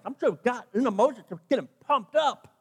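usual speaking voice

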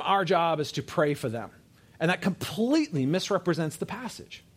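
ordinary talk, nothing marked

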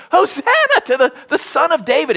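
high-pitched mock shout of children; imitated kids shouting. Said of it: "kids running around shouting".

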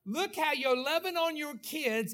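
ordinary talk

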